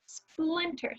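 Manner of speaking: teacher modeling the word slowly. Shown as a demonstration. Teacher to students, modeling the word splinter. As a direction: say it clearly for the class to repeat. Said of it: "Say it back to me".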